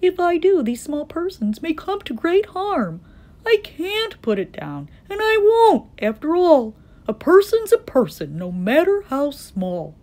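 high, worried elephant turning resolute; fret, then insist loudly; alarmed. A narrator's reading. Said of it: "Horton thought with alarm".

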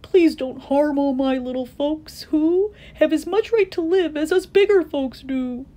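high-pitched, pleading elephant; begging. A narrator's reading. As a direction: plead desperately for little friends. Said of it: "And he begged".

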